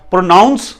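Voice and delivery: प्रोनाउंस as pro-NOWNS, careful model word; correct pronunciation. Shown as a demonstration. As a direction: say प्रोनाउंस with a clear "ow" in the second syllable. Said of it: "नहीं पढ़ना".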